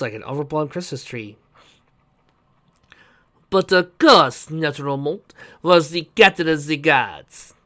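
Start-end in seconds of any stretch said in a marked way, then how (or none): 3.5-7.5 put-on slow, loud, pompous French-accented boast; puffed-up, higher-pitched French voice; exaggerated pride. A narrator's reading. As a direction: draw it out grandly like a self-important guard captain. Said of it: "with his exaggerated pride".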